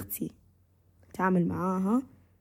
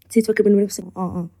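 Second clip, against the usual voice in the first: ordinary delivery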